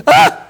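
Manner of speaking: very high, loud yelping "Ah!"; sudden high yelp. Shown as a demonstration. Dad pranking his daughters first, high yelp. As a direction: leap up into a startled yelp. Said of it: "I look at them and go".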